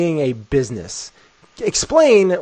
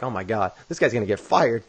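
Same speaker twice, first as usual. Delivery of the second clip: a mortified aside under the breath; hushed inner thought; 6 semitones lower, 7 dB quieter than usual. Retold or imitated. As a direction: drop the voice low and soft, as if thinking aloud. Inner thought, awkward moment.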